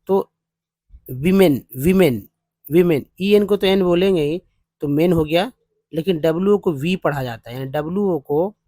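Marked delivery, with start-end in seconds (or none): none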